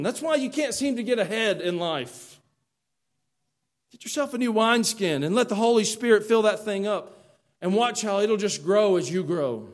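loud, driving preacher's call; fiery preaching. Acted. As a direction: preach it hard to the pews. Preaching at full force.

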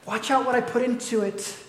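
high, mock-smug voice of the crowd; mocking everybody. Preacher mimics churchgoers in a high, smug voice. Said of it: "And everybody's like".

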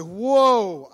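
loud, high, drawn-out protest; shocked objection. Acted. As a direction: stop the pastor short.

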